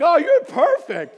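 high, loud gushing mom voice; doting mom. Retold or imitated. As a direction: gush over a child like a doting mother. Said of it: "He's like my mom".

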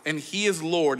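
ordinary delivery

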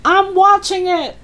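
high-pitched grandma call; shouting back. Acted. Grandma assures George she sees it, high shout. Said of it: "the old girl shouted back".

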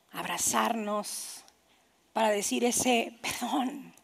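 slow, low and heavy with pauses; grave, unhurried plea to forgive; solemn appeal. Real, not acted. Solemn appeal after imagining death.

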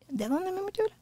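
very high, soft put-on voice; high flirty voice. Asking a cutie where she's from, mock high voice. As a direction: squeak it softly, imitating a flirt.

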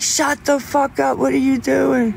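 mock dad snapping at singing in shower; imitating angry dad. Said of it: "He gets so mad".

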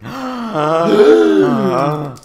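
high, very loud sustained cry; loud drawn-out wail. Acted. Narrator breaks off the story, wailing loudly. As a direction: wail it out at full force. Wail after a shouted accusation.

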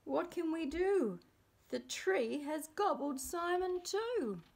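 high-pitched storybook panic; yelling. A narrator's reading. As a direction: wail it in acted desperation. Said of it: "yelled Zack".

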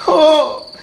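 high, loud scared cry; frightened gasp. A narrator's reading. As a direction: cry out in fear. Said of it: "exclamó".

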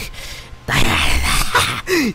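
loud, unhinged villain cackle; hammed-up evil laugh; mad villain laugh. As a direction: cackle like a madman. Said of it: "That's my mad voice".

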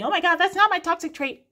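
high, loud, fast indignant protest; mock viewer denial. Tarot reader mocking a Pisces viewer who denies the trait, high and loud. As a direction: squeal it like an offended viewer brushing it off. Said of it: "whether you're watching this thinking".

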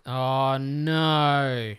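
ordinary talk, nothing marked

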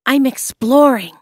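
put-on eager boy. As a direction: answer with eager pride.

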